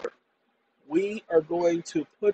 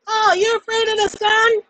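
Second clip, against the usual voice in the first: high falsetto voice; 4 dB louder than usual. Acted. High put-on mocking voice.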